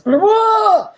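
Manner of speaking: high, loud startled whoa; mock shocked whoa. Mimicking people shocked at free healthcare. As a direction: gasp like the word scares them.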